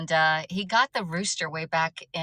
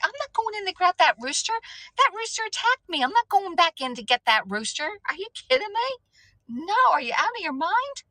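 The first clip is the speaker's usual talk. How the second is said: much higher, louder, outraged and incredulous; high indignant refusal; 14 semitones higher, 4 dB louder than usual. Retold or imitated. Refusing coworker Lisa's request to grab the rooster, high indignant squeal. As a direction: squeal out a horrified no way. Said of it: "I'm like".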